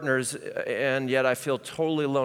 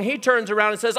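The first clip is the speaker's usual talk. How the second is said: ordinary delivery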